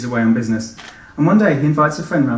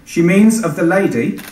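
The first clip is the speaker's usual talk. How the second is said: slow, louder, pointedly curt; unnecessary tone; 7 semitones higher, 7 dB louder, slower than usual. Acted. Policeman curtly clarifying to Tony, sharp tone. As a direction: correct her with a needless edge. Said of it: "His tone wasn't necessary".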